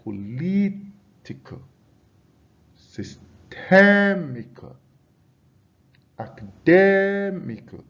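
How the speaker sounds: "political, systemical, Academical" with LIT, TEM and DEM held long and high; stress on third-last syllable. Shown as a demonstration. Teacher showing a stress rule to the class, stressed syllables drawn out. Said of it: "stress on the third syllable from the end".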